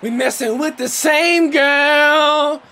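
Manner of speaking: very high, loud, stretched-out holler; real full-voiced shout, words drawn out; yelling. Real, not acted. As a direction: yell it right in their ears. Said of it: "can you not yell in my ears".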